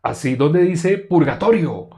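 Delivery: loud, high, slow furious challenge; imitating an angry man's shout; angry demand. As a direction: bark the demand, stretching each word. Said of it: "Así me dijo él".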